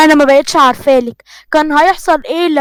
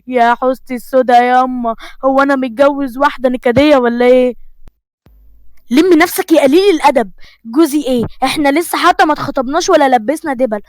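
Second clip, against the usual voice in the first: ordinary delivery, nothing marked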